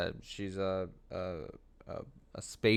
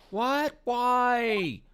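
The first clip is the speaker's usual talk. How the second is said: much higher, piercing what and why; shocked girl; 4 dB louder than usual. Acted. Sidekick girl hearing the show is cancelled, high shocked yelp. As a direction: yelp out the disbelief.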